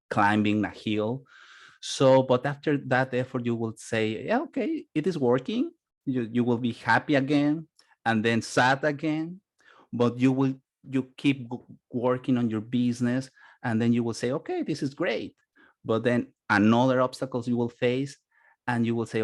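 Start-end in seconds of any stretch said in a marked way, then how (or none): 4.2-5.7 high-pitched, lightly acted happy realization; pleased realization. Voices entrepreneur's relief as things work. 14.3-15.3 lightly acted delight, pitched up; pleased. Voices entrepreneur happy again.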